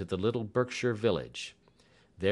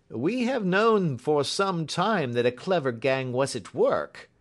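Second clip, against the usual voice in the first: high, loud inspector voice; raised, emphatic policeman voice; official inspector; 8 semitones higher, 6 dB louder than usual. A narrator's reading. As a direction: report the case with authority.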